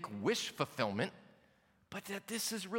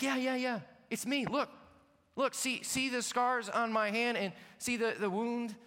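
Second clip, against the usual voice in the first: eager, slightly raised insisting; Jesus pleading with frightened disciples, lightly voiced; insistent Jesus voice; 6 semitones higher than usual. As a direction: convince doubting friends it's really you.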